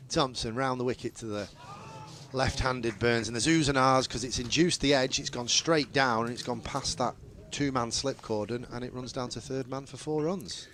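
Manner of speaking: lightly voiced cricket commentary, steady and measured; live play-by-play. Commentator calling the ball to radio listeners, measured play-by-play.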